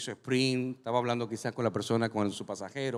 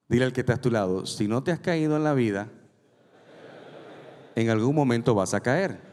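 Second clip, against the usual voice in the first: preaching at full force; 3 semitones lower, 7 dB louder than usual. Acted. Pastor has the congregation repeat to a neighbour, full preaching. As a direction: proclaim it so the back row hears.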